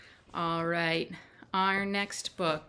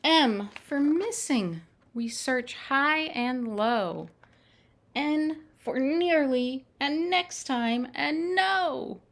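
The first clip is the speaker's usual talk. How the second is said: higher, eager picture-book voice; excited reading; 7 semitones higher, 6 dB louder, slower than usual. Acted. Storyteller reads the runaway page to children, excited. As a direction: read it like the chase is on.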